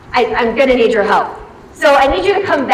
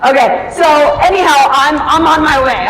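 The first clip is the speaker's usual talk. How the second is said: usual speaking voice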